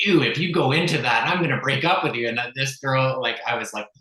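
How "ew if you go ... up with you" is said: high, loud, fast ultimatum; mock-outraged girlfriend voice; imitated disgusted girlfriend. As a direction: mimic a girlfriend's threat, pitched up and pushed. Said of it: "the girlfriend at the time was like".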